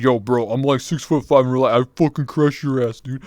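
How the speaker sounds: playful mock threat; mock tough guy. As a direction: imitate a big guy talking tough. Tall guy retold, acting his boast.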